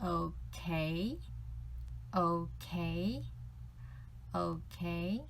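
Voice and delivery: okay dipped on O then rising on K. Shown as a demonstration. Teacher showing English okay as third plus second tone. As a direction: dip then lift. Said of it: "O is third tone, K is second tone".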